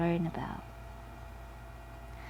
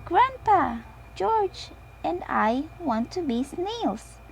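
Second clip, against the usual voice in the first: high, eager little pig; pleading high piglet asking grandpa, slow; young piglet eagerly asks grandpa; Peppa voice; 8 semitones higher, 3 dB louder than usual. A narrator's reading. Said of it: "says Peppa".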